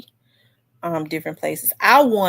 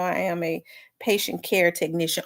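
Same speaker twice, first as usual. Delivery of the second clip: usual speaking voice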